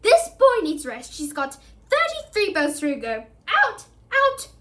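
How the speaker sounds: loud, slow, then a high shout of Out. A narrator's reading. Nurse drives the visitors out, shouting. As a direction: storm in and throw them all out. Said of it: "came storming over and shouting".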